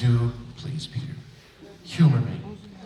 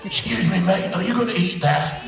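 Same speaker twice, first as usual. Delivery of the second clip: higher, light creature voice; pond creature; 7 semitones higher than usual. Pretend. Two voice actors perform a pond-in-the-forest impression, cheeky creature. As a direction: play a cheeky pond critter. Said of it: "our impression of a pond in the forest".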